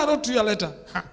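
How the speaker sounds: ordinary talk, nothing marked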